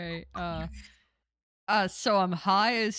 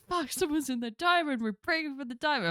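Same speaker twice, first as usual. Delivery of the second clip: alarmed; 8 semitones higher than usual. Pretend. Panicked character reacting to alarm.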